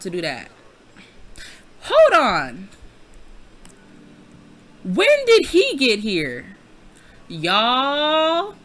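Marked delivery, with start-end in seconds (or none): 1.8-2.7 loud, startled halt; shocked double-take. Real, not acted. Player spotting an intruder in the game, shocked. 4.8-8.5 loud, high, drawn-out astonishment; shocked disbelief. Real, not acted. Player who just noticed [name] in the room, astonished.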